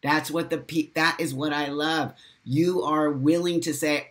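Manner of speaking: ordinary delivery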